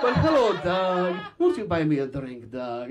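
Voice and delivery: a seductive Russian hostess purring in a put-on Russian accent; sultry Russian accent, played big for laughs; mock thick Russian accent. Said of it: "The thick Russian accent".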